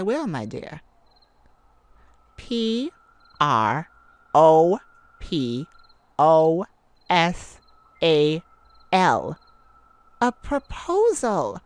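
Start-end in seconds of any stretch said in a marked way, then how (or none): 2.4-9.4 each letter P R O P O S A L punched loud, one by one; spelled out. Shown as a demonstration. Teasing her wolf, spelling it out letter by letter. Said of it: "Do I have to spell it out?".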